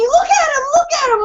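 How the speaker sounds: high, loud frantic cries; shrill-pitched urgent yelling, acted out; retold screaming. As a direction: imitate a friend screaming in panic. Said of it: "she's in here screaming".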